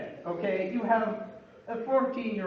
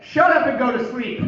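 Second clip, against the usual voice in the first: higher and much louder gruff snap; retold yell; 5 semitones higher, 9 dB louder than usual. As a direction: bark it back at him, rude and sleepy. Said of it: "now you're yelling at me to go to sleep".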